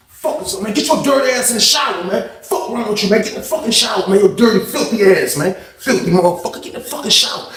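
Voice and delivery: high, strained ranting; angry tirade. Retold or imitated. Inmate orders dirty cellmate to shower, angry tirade. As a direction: order him off in a high-pitched fury. Retold yelling at drenched cellmate.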